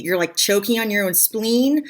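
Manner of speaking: ordinary talk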